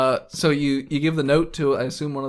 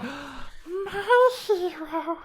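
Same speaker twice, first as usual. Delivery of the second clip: high breathy damsel, very high; swooning lady voice. Acted. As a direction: gush like a rescued damsel.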